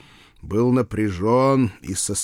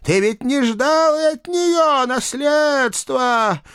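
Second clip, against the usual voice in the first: high, wailing ghost aunt; theatrical ghostly lament; sepulchral reproach; 4 dB louder than usual. A narrator's reading. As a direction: stretch each accusation into a wail. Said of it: "за могильным голосом продолжала женщина".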